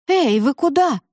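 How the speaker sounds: high alarmed call. Acted. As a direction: call after them, alarmed. Eva calls after friends.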